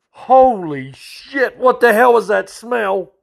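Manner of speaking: high, slow, drawn-out holy shit; disgusted shock. Retold or imitated. Trooper reeling from the stench in the cruiser, high and drawn-out. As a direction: recoil from a horrible stench. Trooper hit by awful smell.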